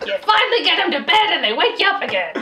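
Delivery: mock mom rant. Same yelled mom rant.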